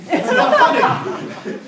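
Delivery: laughing. Real, not acted. Laughing at baby joke.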